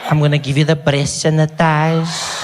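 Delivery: slow, stretched come-on; seductive counter woman. Acted. Woman answering a customer's supposed flirting, slow seductive voice. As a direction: draw it out suggestively in a put-on voice.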